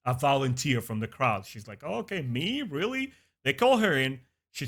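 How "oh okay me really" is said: rising, pitched-up surprise, softer; playful disbelief at being picked; mock surprise. Letara chosen from the crowd, mock surprised and high.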